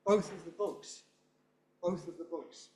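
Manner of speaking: "both" and "books" stressed, "of the" weak; stressed quantifier. Shown as a demonstration. Teacher modelling quantifiers, stress on both. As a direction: lean on "both", glide over "of the". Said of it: "Quantifiers typically are stressed".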